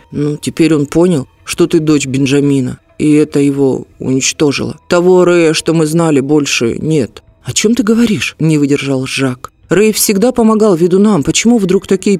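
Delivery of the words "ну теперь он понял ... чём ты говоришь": ordinary delivery, nothing marked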